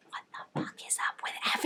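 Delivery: hushed, incredulous whisper; whispered aside. Retold or imitated. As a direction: mutter it under your breath in disbelief. Her own reaction while watching.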